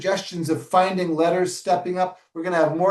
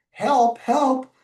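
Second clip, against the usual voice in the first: raised high duck cry; 8 semitones higher, 3 dB louder than usual. Acted. Teacher reading to children, high duck voice. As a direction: call it up high like the little duck. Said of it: "the voices were distinct for each animal".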